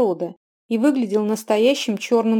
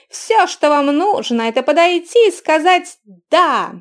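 loud sing-song hawker pitch; loud carnival barker; 6 semitones higher, 6 dB louder than usual. A narrator's reading. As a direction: keep drawing the crowd in. Barker keeps calling to crowd.

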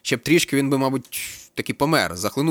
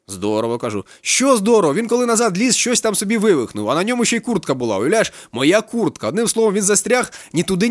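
high, loud, worked-up storytelling; agitated police captain; 8 semitones higher, 5 dB louder than usual. A narrator's reading. Captain vents to the student about his son, agitated and loud. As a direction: rant about his son like an exasperated father.

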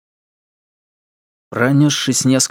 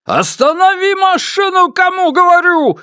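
frantic, much higher screaming order; hysterical shouting; 4 dB louder than usual. Acted. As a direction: scream it, losing control. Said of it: "истерично закричал Ма Дунчень".

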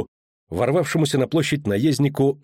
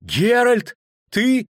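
high, loud cry of surprise; startled joy at a friend; surprised; 11 semitones higher, 4 dB louder, much slower than usual. Acted. Dandelion spotting Geralt in the crowd, high surprised cry.

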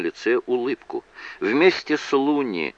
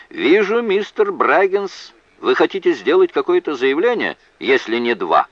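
high-pitched, raised judge's voice; judge addressing counsel; 9 semitones higher, 4 dB louder than usual. A narrator's reading. Judge asking counsel about his motions, high raised voice.

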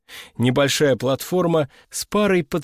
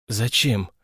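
wary; 4 semitones lower than usual. Acted. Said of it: "настороженно нахмурился я".

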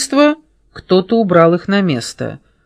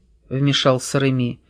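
ordinary delivery, nothing marked

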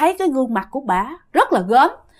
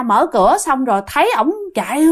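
usual speaking voice

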